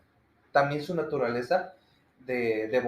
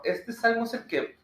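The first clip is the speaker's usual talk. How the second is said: ordinary delivery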